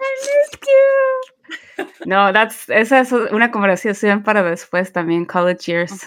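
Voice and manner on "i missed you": high, long-drawn affectionate squeal; mock gushing. Playing along with her friend's reunion, high gushing. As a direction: stretch it out slowly, way up high.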